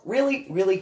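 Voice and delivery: high, loud incredulous questioning; indignant challenge. Retold or imitated. Answering a classmate's "such a girl" jab, indignant. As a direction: throw it back as a challenge.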